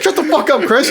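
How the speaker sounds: high-pitched mock fury; retold angry outburst. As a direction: shut him down as an exasperated wife. Wife snaps at him over phone.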